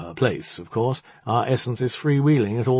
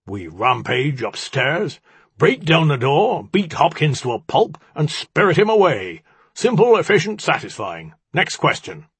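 bullish plan in a loud, raised voice; booming, basalt-thick; 6 semitones higher, 5 dB louder than usual. A narrator's reading. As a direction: boom out the battle plan with brute confidence. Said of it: "His basalt-thick voice boomed".

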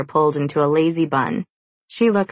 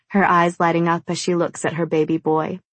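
ordinary delivery, nothing marked

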